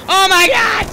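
high, loud panicked yelling. Real, not acted. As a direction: shout it in frantic alarm. Panic in game.

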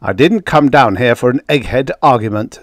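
impatient, forceful complaint; snapped. A narrator's reading. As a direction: shut down the academic debate. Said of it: "snapped Speidel".

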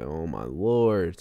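low, slow, weary exclamation; deep sigh of words, retold; exasperated aside. Exasperated at mother's insistence.

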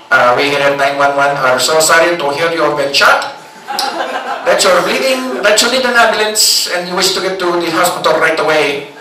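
put-on unhurried operator script in Filipino accent; over-polite agent, put-on Filipino accent. Operator repeats caller's emergency back, Filipino accent. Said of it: "outsource nine one one to the Philippines".

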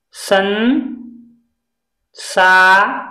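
संसार stretched slowly with nasal n; teacher slowing संसार; slow syllable demo. Shown as a demonstration. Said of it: "अंक की मात्रा से हम हमेशा दो उच्चारण".

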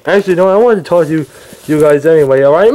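normal talking voice

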